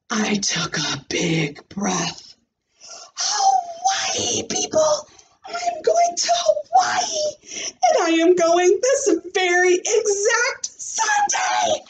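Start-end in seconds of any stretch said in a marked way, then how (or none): 3.1-11.8 put-on high squealing little girl; excited yell. Junie B telling classmates about Hawaii, high excited yell. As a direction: yell it to the whole room, thrilled. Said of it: "that's like yelling on paper".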